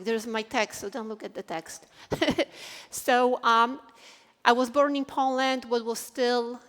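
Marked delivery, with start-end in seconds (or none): 2.1-2.9 short breathy laugh. Real, not acted. Laughs at her own text.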